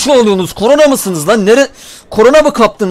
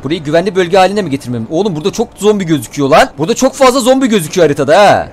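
normal talking voice

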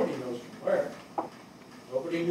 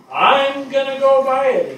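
higher, louder and slower, like a man set on a plan; Dave's eager announcement; 8 semitones higher, 8 dB louder than usual. Retold or imitated. Firefighter quoting Dave's plan to buy the car, in an eager raised voice. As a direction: announce it like you've already made up your mind. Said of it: "he tells us".

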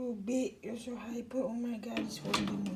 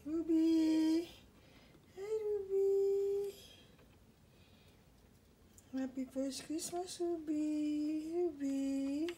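pet-talk greeting; 4 semitones higher than usual. Real, not acted. Owner greeting Ruby on first Christmas, high doting pet-talk. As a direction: coo the name to a beloved pet.

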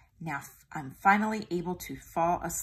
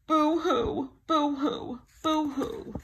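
higher, louder boo-hoo sobs; mock crying; 9 semitones higher, 3 dB louder, much slower than usual. A narrator's reading. Tiger crying in the dark closet, mock sobbing. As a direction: blubber like a scared cub.

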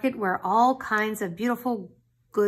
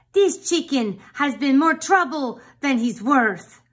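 loud, fed-up cook, higher; exasperated exclamation; 6 semitones higher, 9 dB louder than usual. Acted. Cook fed up with the troublesome chicken, loud and exasperated. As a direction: throw up your hands at the rooster, loud. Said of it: "exclaimed the cook".

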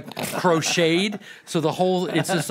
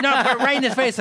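insistent Pesci; 7 semitones higher, 3 dB louder, faster than usual. Retold or imitated.

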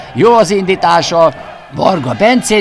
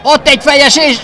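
excited; 8 semitones higher, 5 dB louder than usual. Real, not acted. Commentator calls a header chance.

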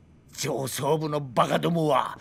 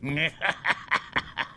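put-on short soft drunken laugh; low bitter snicker; drunken chuckle; 3 semitones lower, 4 dB quieter than usual. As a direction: chuckle to yourself, a little tipsy.

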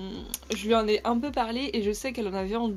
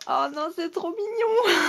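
high delighted gush over a cute gift; delighted squeal; 8 semitones higher, 3 dB louder than usual. Real, not acted.

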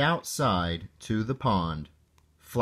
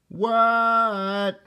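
high, stretched-out reply; calling back; 11 semitones higher, 4 dB louder than usual. A narrator's reading. Froggy answering his mother's call, high called-back reply. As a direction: holler back in a high kid voice.

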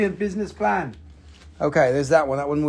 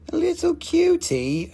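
high cooing little cutie; baby talk to a tiny rock; 11 semitones higher than usual. Acted. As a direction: coo over it like a baby animal. Cooing at a small crystal.

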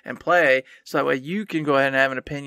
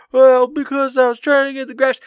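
mock whiny excuse; 8 semitones higher, 6 dB louder than usual. Mocking past self's excuse.

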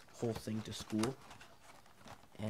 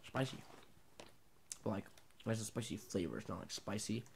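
eating cured meat slice; chewing while talking; 4 dB quieter than usual. Real, not acted. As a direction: mouth partly full of food. Seen 1: Salami slice goes into mouth at -4; cheeks and lips keep chewing while talking.

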